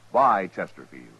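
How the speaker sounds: ordinary delivery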